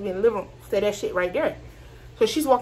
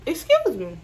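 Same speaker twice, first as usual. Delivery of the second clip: a higher, mock petty "excuse me"; 6 semitones higher than usual. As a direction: toss off "excuse me" like a petty roommate would. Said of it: "I feel like a bitch gonna be petty".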